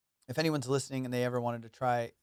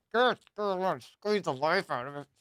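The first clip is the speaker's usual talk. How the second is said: cute aggression; 5 semitones higher, much slower than usual. Acted. Acts out squeezing something cute.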